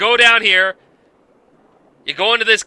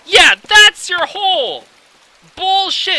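slow, high, mocking shout of disbelief; sarcastic yell; 10 semitones higher, 5 dB louder, slower than usual. Real, not acted. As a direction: drag it out loud and sarcastic. Sarcastic outburst at hidden hole.

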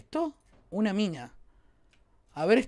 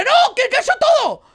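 alarmed yell; 12 semitones higher, 7 dB louder, slower than usual. Real, not acted. El ascensor se desploma.

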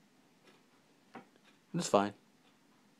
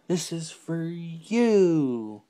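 very slow, higher, stretched on "you"; grand dedication; 6 semitones higher, much slower than usual. Pretend. Vlogger dedicating it to viewers, hammed-up slow dedication. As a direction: draw it out with a big swoop on "you".

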